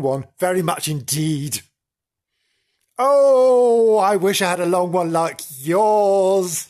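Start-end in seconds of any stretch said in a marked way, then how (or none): 3.0-6.7 high, loud longing wish; wistful character voice. A narrator's reading. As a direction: wish aloud, high and yearning. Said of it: "he said".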